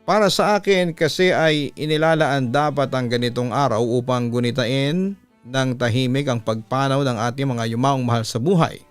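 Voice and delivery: usual speaking voice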